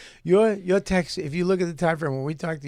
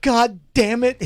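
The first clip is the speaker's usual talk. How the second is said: higher, louder laughing yell; mock outburst; 6 semitones higher, 4 dB louder than usual. Retelling the friend's reaction to the dings, laughing shout. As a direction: burst out like a fed-up buddy.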